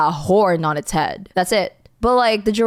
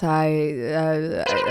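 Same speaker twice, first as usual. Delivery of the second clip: ordinary delivery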